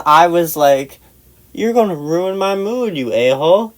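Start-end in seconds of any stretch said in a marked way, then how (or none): 1.5-3.7 high, petulant complaint falling at the end; retold whining retort; whiny indignation. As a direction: protest like a tipsy sulker. Retold tipsy quarrel.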